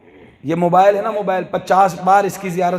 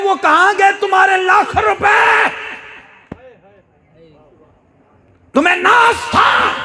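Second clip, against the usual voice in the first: fiery sermon shout; 10 semitones higher than usual. Acted. Preacher rebuking proud parents, full-force shout. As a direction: build to a ringing shout at the congregation.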